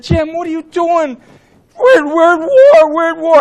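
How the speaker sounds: high, slow, mock-aghast chairman; protesting chairman. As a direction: wail the protest high, imitating an exasperated chairman. Retold chairman protesting in alarm.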